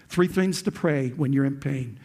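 usual speaking voice

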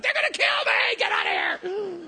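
high, loud panicked yell; hammed-up shriek of alarm; mock panic. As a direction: scream it in comic terror. Acting panic beside still worshipper.

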